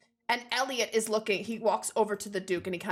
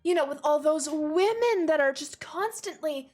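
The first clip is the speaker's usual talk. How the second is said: gushing society lady; 9 semitones higher, 4 dB louder than usual. Acted. Ladies approach the Duke at the party, high gushing greeting. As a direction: greet him with loud, sugary show. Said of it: "looking at the Duke and saying".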